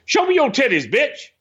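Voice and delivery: high, put-on [name] voice, cocky; [name] at the bar. [name] mocking a woman at the bar, in a high cocky voice. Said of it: "He's like".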